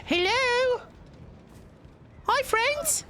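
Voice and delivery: very high, drawn-out cutesy greeting; silly high-pitched voice demo; high squeaky voice. Shown as a demonstration. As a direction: pipe it up into falsetto.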